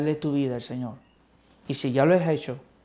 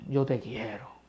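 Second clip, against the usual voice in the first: softer, lower, subdued; quiet answer; 5 semitones lower, 9 dB quieter than usual. Acted. Ashamed Peter answering Christ at the fire, quiet and low. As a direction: say it small and ashamed.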